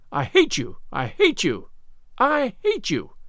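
put-on childish fury climbing in pitch; mounting hatred. A narrator's reading. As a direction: build to a high final cry. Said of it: "A louder stamp with each assertion".

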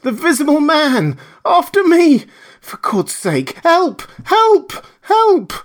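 high, loud panicked cries for help; desperate high screaming; terrified shrieking. Acted. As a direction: shriek for help, fleeing a killer. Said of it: "his voice shrieking with terror".

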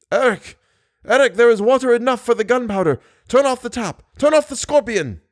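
high, desperate calling out; urgent shouting. A narrator's reading. Captive begs Eric as water rises, urgent shout.

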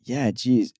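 usual speaking voice

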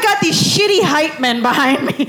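half-laughing, high-pitched grumble; exasperated. Acted. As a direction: whine in mock exasperation at your useless backup. Exasperated complaint about hecklers behind him.

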